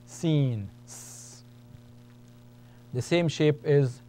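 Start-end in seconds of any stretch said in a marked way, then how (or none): 0.9-1.4 S as a bare voiceless hiss; just hiss the S; Arabic letter sound demo. Shown as a demonstration. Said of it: "Like a hissing sound".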